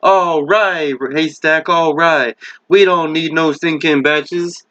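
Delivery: ordinary delivery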